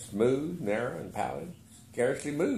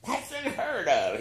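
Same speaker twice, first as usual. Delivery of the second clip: indignant; 11 semitones higher, slower than usual. A narrator's reading. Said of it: "flew into a passion".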